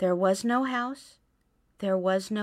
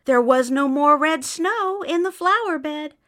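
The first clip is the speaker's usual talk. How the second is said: plain reading voice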